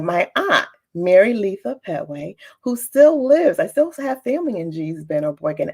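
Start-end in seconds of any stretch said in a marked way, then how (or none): none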